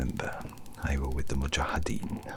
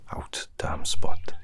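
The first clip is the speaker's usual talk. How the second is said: forceful stage whisper; 9 dB louder, slower than usual. Acted. Quotes Macbeth, bursting out.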